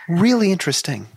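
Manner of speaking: high-pitched, genuinely fascinated; elevated voice. Real, not acted. Agreeing the film news is interesting, elevated voice. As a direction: let excitement push the pitch up. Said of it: "talking in an elevated, elevated voice".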